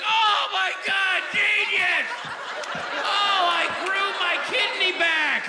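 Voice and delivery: high, loud and slow, over-the-top delight; gushing fan. Retold or imitated. Comic mocking a fan who laughs too hard. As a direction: squeal it like the fan who thinks this is genius. Mimicking audience member's overjoyed reaction.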